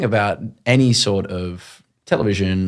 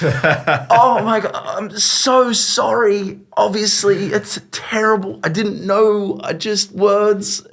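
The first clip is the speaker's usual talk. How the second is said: high, slow, pleading apology; grovel, high and drawn out; mock panic; 10 semitones higher, slower than usual. Host apologising to his director, high, slow and pleading. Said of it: "I'm like".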